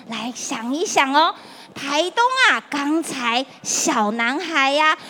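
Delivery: put-on lilting, friendly voice for kids; reading to a toddler. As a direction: talk to little children warmly. Quiz with children. (给小朋友讲故事的语气)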